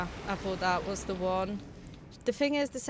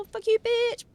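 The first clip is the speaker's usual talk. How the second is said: mock boss taunt; 12 semitones higher, 8 dB louder than usual. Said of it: "and she's like".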